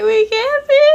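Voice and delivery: high and loud, a mock wail; wailing cry. Crying to her ex on the phone: high, loud mock wail.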